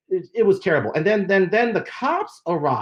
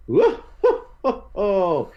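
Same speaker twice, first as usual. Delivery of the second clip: drawn-out whooping chuckle; slow, silly woo-hoo breaking into ho-hos; playful whoop; much slower than usual. Real, not acted. Host opening the show, playful drawn-out whoop.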